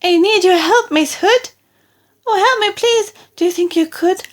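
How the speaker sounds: high desperate squeal; frantic plea. Acted. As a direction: beg for help in a rush of fear. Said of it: "Pig cried".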